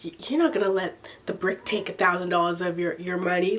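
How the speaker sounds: hushed sales pitch. Retold or imitated. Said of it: "the sales guy's like".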